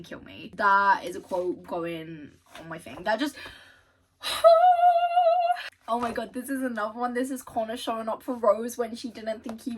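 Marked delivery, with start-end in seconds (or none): 4.2-5.8 a long high-pitched swooning cry; high loud swoon; high emotional wail. Real, not acted. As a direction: let out an overwhelmed wail. Overwhelmed by the quote.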